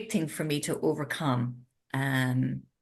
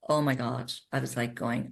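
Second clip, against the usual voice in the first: usual speaking voice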